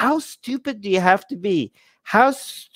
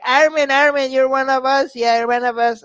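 mock nationalist fan; 6 semitones higher than usual. Mocking North Indian fans who tell him he's one of them, hammed-up. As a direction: ham up a pushy fan claiming him. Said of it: "I kept on saying, like".

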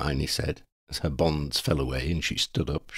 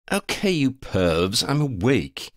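slow, raised character voice; Lavender, mock annoyed; 7 semitones higher, slower than usual. Lavender tells the boys she is awake, mock annoyed. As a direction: draw it out, pitched up, mock annoyed.

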